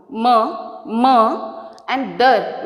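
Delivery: "ma" repeated on its own, then "dar" set apart; isolated syllables. Shown as a demonstration. Teacher showing each vowel sound, "ma" and "dar" isolated. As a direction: separate "ma" from "dar" with short gaps. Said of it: "this O is giving one sound".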